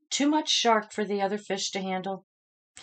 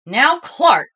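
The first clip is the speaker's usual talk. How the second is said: louder, firm warning; stern teacher; 6 semitones higher, 9 dB louder than usual. A narrator's reading. Teacher calling Clark to order, firm and louder. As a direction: call him to order. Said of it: "said Missus Inky Dink".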